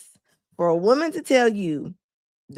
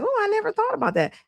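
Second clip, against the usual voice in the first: mock realization; 9 semitones higher, faster than usual.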